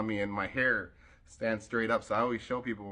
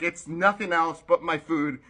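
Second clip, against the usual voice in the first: usual speaking voice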